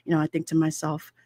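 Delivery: ordinary talk, nothing marked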